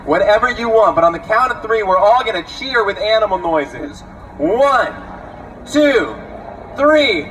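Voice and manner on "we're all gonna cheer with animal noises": normal talking voice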